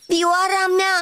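high, frightened outcry; terrified cry. Acted. Cricket seeing his violin hit, terrified cry. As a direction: cry out in sudden horror. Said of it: "a strigat îngrozit".

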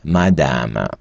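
"мадама" as French madame, final e silent; low, careful model of "мадама"; French reading. Shown as a demonstration. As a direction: read "мадама" out for learners in French. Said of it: "І все слово читається як".

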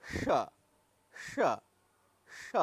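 retroflex স with tongue pulled further back; old retroflex sh demo; retroflex sound demo. Shown as a demonstration. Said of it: "জিভটি আর একটু পিছিয়ে নিয়ে যেতে হবে".

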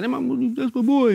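imitating an excited, proud friend; proud shout-out. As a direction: brag about your friend with delight. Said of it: "he said".

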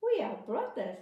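high, loud little mouse voice; squeaky mouse. Acted. Mouse promising the barber, high and loud. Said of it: "said the mouse".

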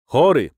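"chory" punched high and loud; emphatic repeat. A narrator's reading. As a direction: hammer home the word "chory". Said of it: "powtórzył z naciskiem".

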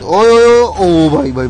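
sudden loud excited yell; excited shout. Real, not acted. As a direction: shout out in surprise. Sudden game moment, calling out.

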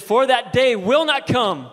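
slow, loud proclaiming; slow preached quote. Acted. Pastor quotes Paul to the church, slow and loud. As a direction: proclaim it slowly.

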